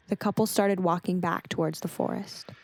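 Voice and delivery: ordinary delivery, nothing marked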